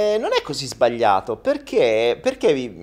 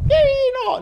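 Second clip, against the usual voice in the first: put-on failed old lady voice; 6 dB louder than usual. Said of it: "Non so fare la voce da vecchio".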